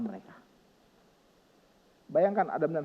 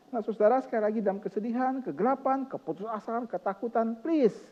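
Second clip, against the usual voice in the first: higher, pressing sermon appeal; urgent preaching; 7 semitones higher, 5 dB louder than usual. Acted. Pastor urging the congregation, pressing and high. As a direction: plead with the congregation.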